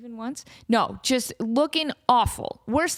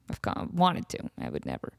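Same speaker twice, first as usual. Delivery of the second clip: whispered aside; 6 semitones lower, 6 dB quieter than usual. Real, not acted. Guilty aside, dropped to hush.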